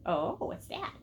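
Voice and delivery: ordinary talk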